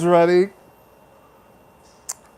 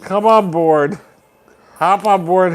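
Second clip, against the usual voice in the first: ordinary delivery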